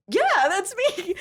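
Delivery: high, loud, drawn-out squeal; retold thrilled, slow high-pitched yes; gleeful confirmation. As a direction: stretch it out in giddy delight. Second delighted reply, retold.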